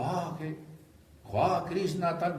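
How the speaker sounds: high, loud cry of rapture; ecstatic gopi cry. Retold or imitated. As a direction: exclaim Krishna's name in rapture. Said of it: "entonces la gopi dijo". (exclamación extática de la gopi, aguda y fuerte)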